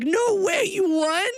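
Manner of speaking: high-pitched astonished question; amazed disbelief. Retold or imitated. Mom hearing daughter won, high surprised disbelief. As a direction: imitate a mom stunned by good news.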